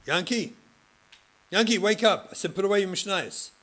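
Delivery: angry shout, high and loud, a bit fast; yelling at student. Retold or imitated. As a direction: bark the name like a fed-up rebbe. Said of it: "you would yell at Yankee".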